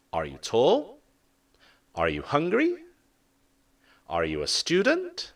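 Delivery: "tall", "hungry", "student" rising at the end; rising yes-no questions. Shown as a demonstration. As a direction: lift each question at the end. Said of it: "We have the rising intonation".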